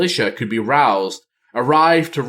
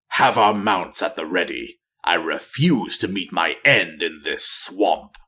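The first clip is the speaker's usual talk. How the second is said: low, cold voice giving orders; commanding dark ranger; 5 semitones lower than usual. Acted. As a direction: give the order without a shred of warmth. Nathanos gives orders.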